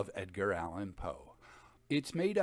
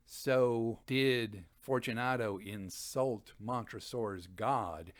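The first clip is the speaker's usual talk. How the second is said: plain reading voice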